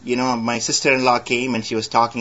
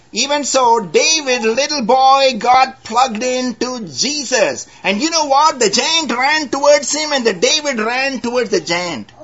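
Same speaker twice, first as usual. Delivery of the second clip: high, ringing preacher's voice; fervent pulpit delivery; preaching; 11 semitones higher, 4 dB louder than usual. Acted. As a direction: lift it up to the congregation. Preaching David's charge.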